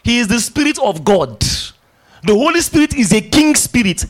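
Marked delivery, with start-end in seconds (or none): 2.2-4.1 higher, louder, insistent; 1 emphatic repeat. Shown as a demonstration. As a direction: drive the line home to the congregation. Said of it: "preacher repeats line for emphasis".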